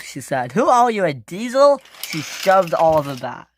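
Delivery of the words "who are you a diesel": loud, high indignant question; affronted and raised in pitch; indignant challenge. Acted. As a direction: challenge whoever just bumped into you, pitched up. Bumped engine confronts Oliver.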